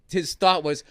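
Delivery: ordinary delivery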